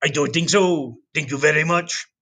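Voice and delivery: a hint of a French accent, lightly voiced; playful French brush-off; mock French. History teacher voicing the French turning Columbus down, mock French. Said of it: "and the French go".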